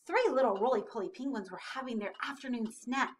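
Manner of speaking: ordinary delivery, nothing marked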